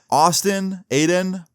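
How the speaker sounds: retold teacher calling across the room; teacher yelling names. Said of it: "we hear the teacher yell out".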